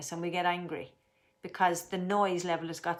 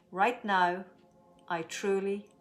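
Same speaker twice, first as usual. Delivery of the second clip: slow calm affirmation; 3 dB louder, slower than usual. Shown as a demonstration. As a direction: say it slowly, letting calm settle. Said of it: "let's make our statement".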